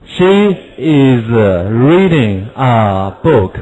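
stretched out, word by word; very slow model sentence. Shown as a demonstration. Teacher reading a model sentence slowly. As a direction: read it slowly for copying.

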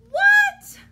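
very high, loud startled What; shocked shout. Acted. Reader shocked by the silly line, loud high outburst. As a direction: explode in mock shock.

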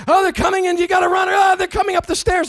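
high, loud panicked warning yell; frantic shout. Retold or imitated. Guy playing the girlfriend shouts they are coming. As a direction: shout the danger like it's real. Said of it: "He was like".

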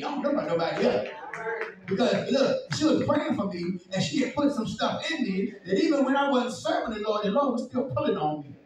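loud, driving preaching; fiery preaching. Acted. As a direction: preach it hard. Preaching at full force.

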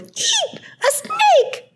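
tiny, extremely high squeal of alarm; squealing mouse. A narrator's reading. Mouse spots the snake under the shelter, squealing. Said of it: "Mouse turned and squealed".

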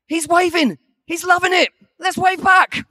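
put-on high, loud excited call to the kids; cheery wife calling out. Wife on the boat cheering the kids to wave back, excited. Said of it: "And Diane's going".

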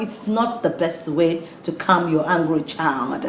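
usual speaking voice

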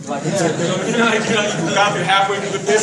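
real amused outburst; laughing. Real, not acted. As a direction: laugh it out in disbelief. Laughing at a clueless customer.

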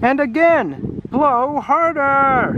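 high, slow, stretched-out cheering on; drawn-out shouting. Acted. Children urging the dragon to blow harder, shouting. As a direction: shout each word long and high. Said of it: "they shouted to the dragon".

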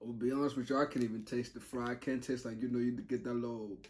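ordinary talk, nothing marked

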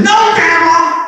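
high, loud mock yell; acted defiant child. Ten-year-old defying grandma, high loud yell. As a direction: shout it as a rebellious little boy.

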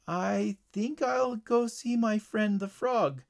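high, slow uneasy voice; uncomfortable. A narrator's reading. Boy facing hornets reluctantly agrees, uncomfortable. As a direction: draw it out, uneasily giving in. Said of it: "Tyler thought uncomfortably".

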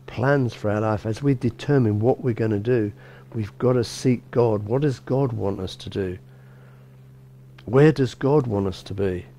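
ordinary talk, nothing marked

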